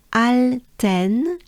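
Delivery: "Alten" drawn out very slowly with an open French A. Shown as a demonstration. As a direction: stretch out the first syllable of "Alten". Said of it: "it's the French A".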